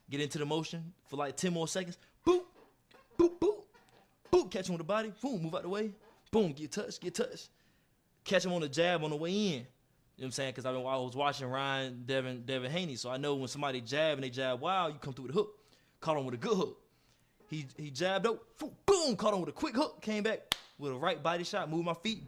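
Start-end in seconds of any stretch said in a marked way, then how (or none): 3.2-3.6 high loud boop punch noises; punch sound effects. Retold or imitated. Acting out body shots in sparring, high punchy boops. 18.6-19.2 high loud boop punch noises; punch sound effects. Retold or imitated. Acting out body shots in sparring, high punchy boops. Imitating jab and hook.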